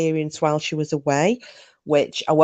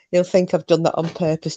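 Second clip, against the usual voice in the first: ordinary delivery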